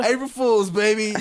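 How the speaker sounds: high, loud mock-triumphant call; jokey gotcha holler; playful shout. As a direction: shout it like a prank reveal. Calls out the gag line jokingly.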